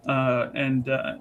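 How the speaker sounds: ordinary talk, nothing marked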